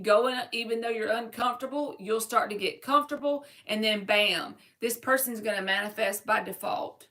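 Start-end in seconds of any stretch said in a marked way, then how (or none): none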